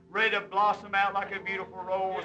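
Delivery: ordinary talk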